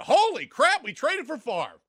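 high, loud fake shock; mock shocked yell. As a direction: shout it in fake surprise.

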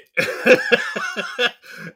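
high airy burst of laughter; cracking up on the mic; real laugh. Real, not acted. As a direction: break up laughing at the slip. Host laughing at his name mix-up.

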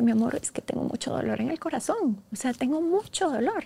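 tender. Retold or imitated. Mother answers daughter tenderly.

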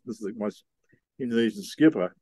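ordinary talk, nothing marked

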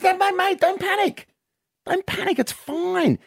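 high, hammed-up reassuring bloke voice; calm a panicking drunk mate, squeaky and eager; pub bloke. Bloke at the bar reassures a drunk mate, high mock voice. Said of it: "another bloke comes up and says".

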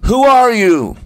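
high, loud call across mountains; loud acted calling-out; storyteller's yell. A narrator's reading. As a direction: yell it out, arching high then falling. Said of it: "So he yelled".

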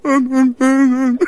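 high whimpering Wookiee moans; sad Wookiee. Retold or imitated. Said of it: "a bit of a sad Wookiee".

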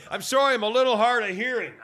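high, loud exasperated complaint; sick and tired of repeating it, raise the voice; fed up. Retold or imitated. Customer fed up with saying he can't hear, exasperated. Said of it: "I'm so sick and tired of saying".

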